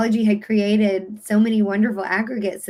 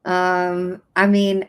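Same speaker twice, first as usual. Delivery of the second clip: ordinary talk, nothing marked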